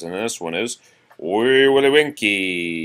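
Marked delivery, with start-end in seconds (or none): 1.2-2.8 slow, raised title announcement; announcing the title. Acted. Parent announcing rhyme title to child, slow.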